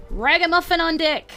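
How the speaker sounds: ordinary delivery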